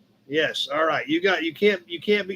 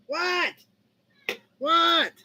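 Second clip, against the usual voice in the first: squeaky pet talk; 9 semitones higher, 3 dB quieter than usual. Real, not acted. High squeak asking cat.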